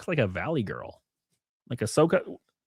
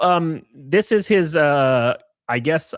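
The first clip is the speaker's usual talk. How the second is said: ordinary talk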